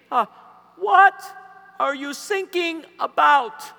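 high, loud, slow German voice; mock German mishearing; German accent. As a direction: ask it like a puzzled German guard. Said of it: "German Coast Guard".

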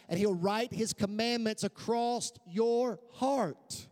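high, emphatic sermon delivery; fervent preaching. Acted. Preacher explaining heart transformation to the church, fervent preaching. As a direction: drive each phrase home to the congregation.

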